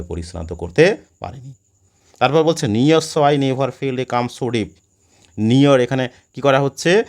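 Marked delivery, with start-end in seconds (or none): 2.7-4.7 slow, measured reading of the verse; poem recitation. Shown as a demonstration. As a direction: read the line out slowly like a poem. Reading poem line aloud.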